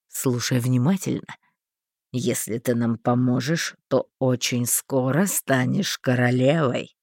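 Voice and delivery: low, slow conspiring man; sly man voice. A narrator's reading. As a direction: lay out the deal slowly. Thin man's offer.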